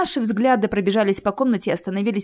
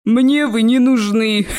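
loud, slow troll voice breaking into laughter; laughing troll; 3 semitones higher, 5 dB louder, slower than usual. A narrator's reading. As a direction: boom it out, then chuckle. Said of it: "рассмеялся тролль". (громогласный смех тролля)

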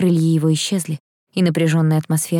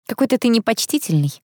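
higher-pitched, mildly miffed remark; wry huff; 7 semitones higher than usual. A narrator's reading. Heroine chiding the irreverent angel, mildly huffy. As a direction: lightly huff it with raised pitch. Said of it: "хмыкнула я".

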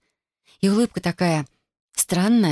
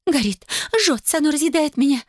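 panicked wail; 10 semitones higher than usual. A narrator's reading. Sue panicking over burning sap.

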